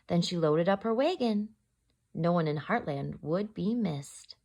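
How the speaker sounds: gentle rhyming read-aloud; story narration. A narrator's reading. Teacher reading to children in storytime voice. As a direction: read it like a bedtime picture book. Reads picture book aloud.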